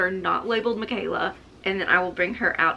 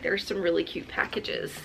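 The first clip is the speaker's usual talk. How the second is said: ordinary talk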